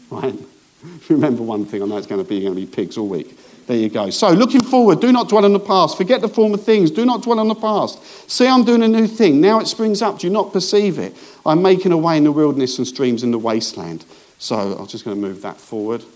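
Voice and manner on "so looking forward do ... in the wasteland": raised, higher preaching voice; preacher lifting the voice to read scripture, forceful. Acted. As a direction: proclaim the verses to the congregation. Reads scripture with full force.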